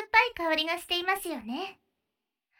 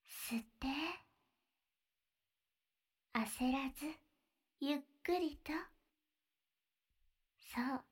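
guided breathing; 3 semitones lower, 7 dB quieter than usual. Acted. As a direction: lead the breathing gently, half whispered. Leads a breathing exercise.

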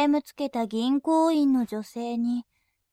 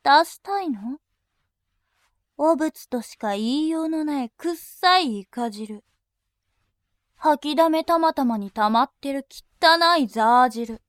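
lower, harsher voice spitting insults, some bursts loud; berating insults; 3 semitones lower, 3 dB louder than usual. Acted. Attendant berating her customer, contemptuous barking insults. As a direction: drop the politeness and tear into him. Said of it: "罵倒しながら".

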